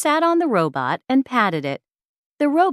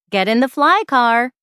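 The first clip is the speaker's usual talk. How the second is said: louder, higher urging call; 5 semitones higher, 5 dB louder than usual. Acted. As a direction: push her to get in now. Mom hurrying Jenny to school.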